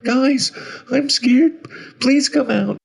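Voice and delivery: slow, very high, loud plea; high frightened calling; frightened little boy. A narrator's reading. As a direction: beg like a scared child. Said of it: "becoming more and more frightened".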